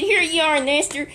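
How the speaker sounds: high, loud and eager, handing it over; proud servants thrilled to return to the master; excited. A narrator's reading. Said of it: "they were so excited".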